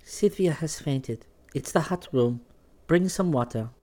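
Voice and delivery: low-pitched, unhurried calm. A narrator's reading. Odile steadying the room after Sylvia faints, calmly. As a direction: keep it composed and low. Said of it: "saying calmly".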